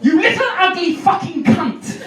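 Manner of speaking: high, spitting insult; snarling abuse. Shown as a demonstration. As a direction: snap it out viciously. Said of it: "droogish, snickety, snap, jabberwocky abuse".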